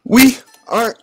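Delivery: slow, high, loud cry; exclaiming. A narrator's reading. Lulu exclaims as things get rough, slow, high and loud. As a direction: cry it out in alarm. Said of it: "Lulu exclaimed".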